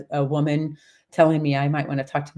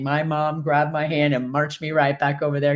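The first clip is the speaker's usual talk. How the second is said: ordinary talk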